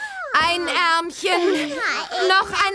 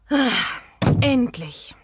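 relieved whisper; 7 semitones lower, much slower than usual. Acted. Parents finally gone, relief.

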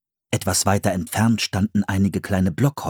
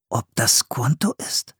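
musing whisper; 3 semitones higher than usual. A narrator's reading. Philipp wonders aloud, whispered. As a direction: wonder to yourself. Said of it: "überlegte Philipp".

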